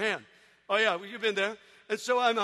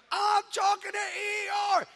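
put-on excited kid shout; 10 semitones higher than usual. Little brother bragging.

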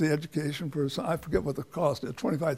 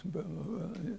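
mumbling lecturer imitation; 13 dB quieter than usual. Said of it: "he kinda talked like this".

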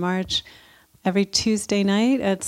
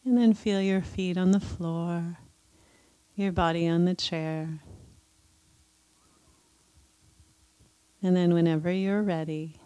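guided meditation; 2 semitones higher than usual. Acted. Leads a loving kindness meditation.

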